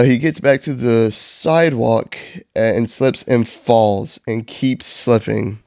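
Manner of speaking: plain reading voice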